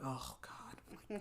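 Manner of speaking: barely audible, unvoiced oh God; whispered dread. Dre hears his dad took a loan, so he whispers in dismay. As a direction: breathe it out in quiet horror.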